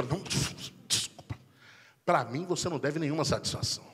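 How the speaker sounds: soft, cold, menacing calm; icy wife. Acted. Wife quietly icy to a late husband. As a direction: speak coldly and quietly like a furious wife.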